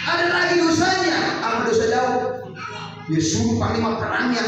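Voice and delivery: loud, high, driving sermon cry; full-force preaching. Acted. Preacher rousing the congregation about sin, shouting high.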